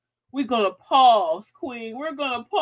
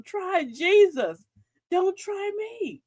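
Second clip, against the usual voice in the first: slow, deliberate, higher warning; slow sassy warning; 8 semitones higher, much slower than usual. Shown as a demonstration. Host warning a troublemaker, slow and pointed. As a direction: spell it out slowly so they get it. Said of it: "Tell them".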